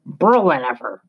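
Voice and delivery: put-on French old sailor spitting words back; short, spat French retort. A narrator's reading. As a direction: fling it back as a crusty French captain. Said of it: "he spat back at Marcus in his native tongue".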